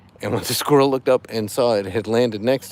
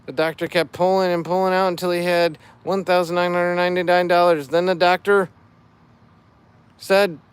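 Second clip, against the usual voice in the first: higher, louder corny fish puns; dad joke voice; 6 semitones higher, 4 dB louder than usual. Acted. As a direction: ham up the pun like a dad. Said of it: "I'm doing it in dad joke voice".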